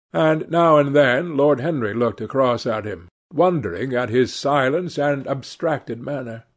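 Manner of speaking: ordinary delivery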